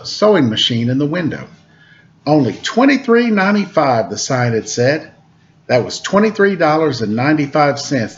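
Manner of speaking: lively read-aloud storytelling voice; storyteller reading a picture book to kids; story narration. A narrator's reading. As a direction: read it to children, bright and clear.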